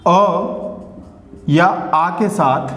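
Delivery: अ या आ के साथ stretched very slowly; slow, drawn out. Shown as a demonstration. Teacher to students, dwelling on the rule, slow and drawn. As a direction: spell it out word by word, slowly. Teacher drawing out key phrase.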